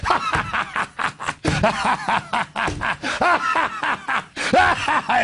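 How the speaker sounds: high cackling laugh. Real, not acted. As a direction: burst into scornful laughter. Maniacal laughing after sarcasm.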